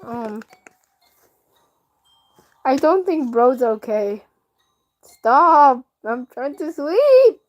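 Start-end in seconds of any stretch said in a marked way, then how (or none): 5.0-7.4 louder and slow, fed up; irritated plea to stop; annoyed yell. Real, not acted. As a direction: tell the cat off, drawn out. Annoyed at the game cat.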